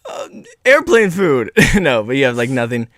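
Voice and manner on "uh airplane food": mock whiny comic. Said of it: "just go".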